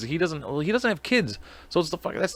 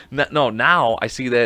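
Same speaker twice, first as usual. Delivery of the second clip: ordinary talk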